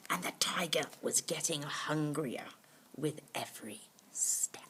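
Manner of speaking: a storyteller's whispered menace; hushed suspense. A narrator's reading. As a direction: lean in and whisper.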